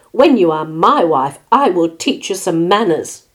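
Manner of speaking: loud, forceful threat; threatening. A narrator's reading. Rooster threatens his bride-to-be, threatening. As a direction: lean hard and loud on the warning. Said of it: "he threatened".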